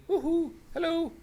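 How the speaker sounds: squeaky high woohoo; silly falsetto hello. Pretend. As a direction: sing out a goofy greeting. Greeting at the urinal.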